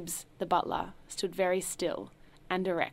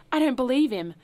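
higher, loud appeal to the room; cried out; 7 semitones higher, 9 dB louder than usual. A narrator's reading. Host crying out to his guests in disbelief, loud. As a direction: cry out to the guests for support. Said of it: "cried out to his guests".

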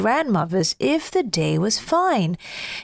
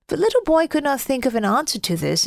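ordinary delivery

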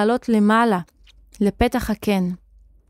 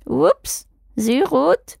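higher, strange voice with mouth barely open; closed-mouth odd voice; 5 semitones higher than usual. A narrator's reading. Wizard warning as things fall, odd closed-mouth voice. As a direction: warn through nearly closed lips. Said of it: "אמר אחי רועם בפה סגור, בקולו המשונה שוב".

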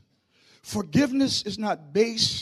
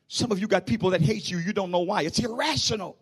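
normal talking voice

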